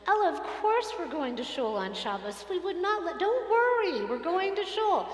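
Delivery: put-on mother comforting her little girl; reassuring mom. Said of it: "she turns to Ella and she goes".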